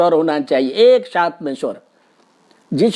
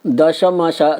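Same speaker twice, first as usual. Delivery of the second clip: ordinary talk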